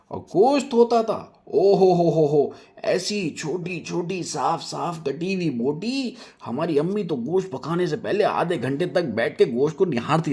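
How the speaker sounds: slow, high, relishing drawl; boasting about her father's meat, savoured; savouring. Acted. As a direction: linger over the memory lovingly.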